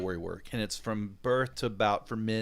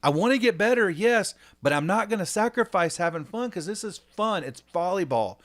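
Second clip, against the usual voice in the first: put-on much higher and louder, pleading kid voice; imitating insistent daughter; 8 semitones higher, 9 dB louder than usual. Daughter insisting to dad she wants fun, high and loud.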